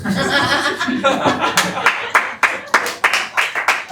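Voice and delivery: high airy laugh; breathy laugh. Real, not acted. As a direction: burst out laughing at the joke. Laughs at own joke.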